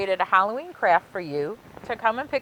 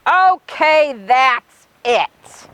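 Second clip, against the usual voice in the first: louder, higher declaration; done at last; 4 semitones higher, 8 dB louder, much slower than usual. Acted. Helper finishing loading Frankenstein up, loud satisfied call. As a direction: announce that the packing is finished.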